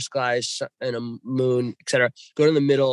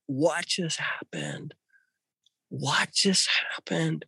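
stunned; 4 dB quieter, slower than usual. Retold or imitated. Stunned after lights vanish.

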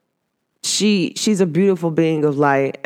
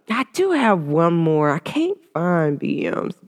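ordinary delivery

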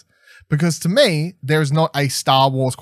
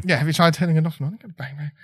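rapid joke quote; 4 semitones higher, much faster than usual. Retold or imitated. Repeating the IT joke.

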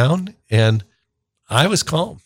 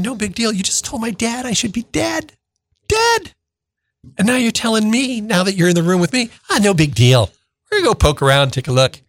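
higher, stunned disbelief at the doctor's words; incredulous kid; 10 semitones higher than usual. Retold or imitated. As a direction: push the pitch up, unable to believe it. Scared kid's disbelieving thought.

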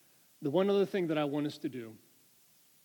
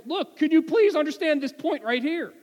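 loud, high emphatic plea; all caps; 12 semitones higher, 13 dB louder than usual. Retold or imitated. Paul writing in all caps to the Corinthians, loud and high. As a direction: shout it like a message typed in all caps. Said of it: "you put it in all caps".

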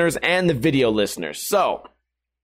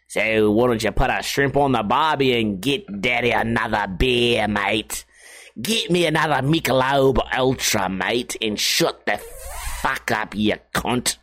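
mock Australian accent; 4 semitones lower than usual. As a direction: tease the bartender in a fake Aussie voice. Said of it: "bartender is from Australia".

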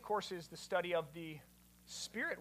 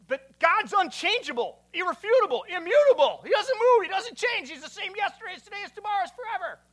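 high, shouted protest; hammed-up indignant outburst, loud and high-pitched; frantic objection; 11 semitones higher, 12 dB louder than usual. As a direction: insist at the top of your voice, panicked.

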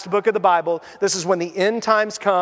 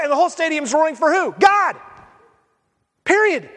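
triumphant call; 7 semitones higher than usual. Acted. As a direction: proclaim it like a roaring crowd. Whole stadium roaring for God.

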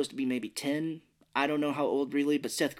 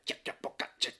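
mock gibberish; 6 dB quieter than usual. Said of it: "most of it's like".

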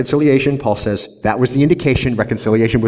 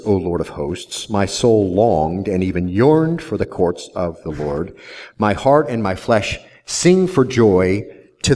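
scripture reading; 3 semitones lower than usual. Acted. Reading Psalm eighty-four aloud.

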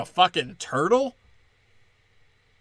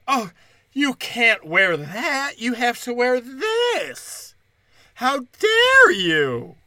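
high, slow indignant whine; mocking voice; 7 semitones higher, 3 dB louder, much slower than usual. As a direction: mimic a shrill scold. Mocking activists' quote.

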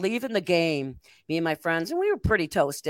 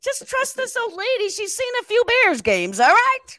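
high-pitched, building to a loud finish; high mock scolding that ends in a shout; playful insisting; 14 semitones higher, 4 dB louder than usual. Real, not acted. Playfully insisting to doubting co-host.

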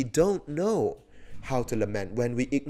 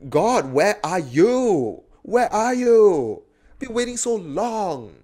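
high, drawn-out call to God; desperate cry; 7 semitones higher, 5 dB louder, slower than usual. Acted. Psalmist waiting for God, acted desperate cry. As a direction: plead slowly and loudly, as if long unanswered. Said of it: "is crying and dying and just waiting".

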